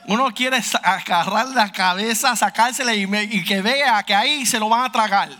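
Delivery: loud, high, impassioned preaching; full-force pulpit preaching; fiery preaching. Acted. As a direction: preach it hard, pleading with the crowd.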